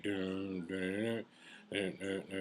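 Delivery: sung-out "dun dun" of a backing track; imitated beat. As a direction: mouth the beat as it drops. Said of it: "Drop the beat".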